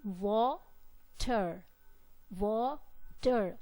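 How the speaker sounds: wa-ter, said syllable by syllable with a break; split into syllables. Shown as a demonstration. Teacher splitting a word into syllables for students. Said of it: "the sounds are breaking".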